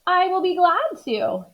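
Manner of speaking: high, sunny helper voice; cheerful Amelia. Acted. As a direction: say it gladly, high. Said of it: "said Amelia Bedelia".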